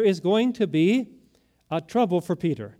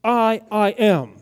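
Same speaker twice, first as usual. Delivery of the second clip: slow, louder, emphatic "I, I am"; 3 semitones higher, 4 dB louder, slower than usual. Shown as a demonstration. Preacher showing Jesus's emphatic self-naming, slow. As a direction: repeat the "I" and weigh each word slowly. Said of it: "That's emphatic".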